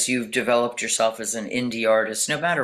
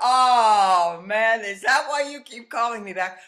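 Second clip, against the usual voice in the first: high-pitched mock dismay, loud opening "oh"; mock exasperation; 9 semitones higher, 4 dB louder than usual. Retelling her reply to the producers, mock exasperated. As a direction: play up a dawning, exasperated realization.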